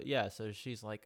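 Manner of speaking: ordinary talk, nothing marked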